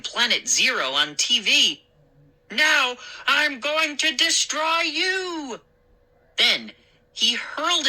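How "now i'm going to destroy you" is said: slow, drawn-out monster threat; zombie monster. Acted. Moose lurching at his friend, slow mock zombie. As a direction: lumber toward him like a movie zombie. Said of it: "like the zombie monster".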